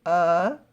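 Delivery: "Ugh" as a held short u sound; isolated vowel sound. Shown as a demonstration. Teacher changing the vowel for pupils, "Ugh" sounded out alone. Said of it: "Gonna change the vowel".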